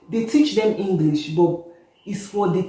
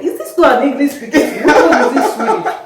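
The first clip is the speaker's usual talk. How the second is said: half-laughing indignant retort, a touch higher and louder; indignant mock outburst; 7 semitones higher, 5 dB louder than usual. Mother retelling the Swedish school meeting, laughing indignation. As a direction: replay the outrage with a laugh in it. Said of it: "I was angry".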